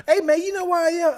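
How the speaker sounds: high, loud eager greeting; excited. Retold or imitated.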